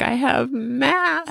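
high, slow whine; imitating a whiny kid. Mother quoting her daughter's tearful complaint, high slow whine. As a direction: drag out the complaint like a crying child. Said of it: "she was like".

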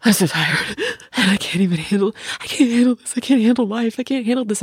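high, strained wail, half-mocked; imitating hysterical crying. Mother mimicking her crying teen daughter, high strained wail. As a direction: wail about not coping. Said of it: "hysterically crying".

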